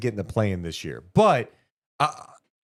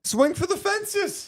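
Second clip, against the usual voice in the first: excited; slower than usual. Acted. Hyped trade plea.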